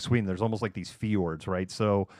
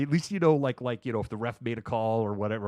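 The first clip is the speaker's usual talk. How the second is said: normal talking voice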